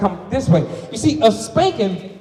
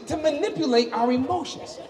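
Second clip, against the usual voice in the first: usual speaking voice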